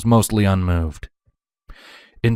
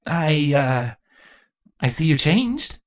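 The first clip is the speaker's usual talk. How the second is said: put-on higher, halting, awkward young voice; awkward remark; 8 semitones higher, slower than usual. A narrator's reading. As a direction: stumble into it, embarrassed. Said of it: "Thorax noted awkwardly".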